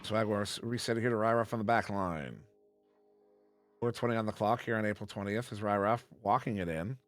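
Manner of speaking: quick, lively sportscaster call; live play-by-play. Acted. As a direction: call the action as it happens.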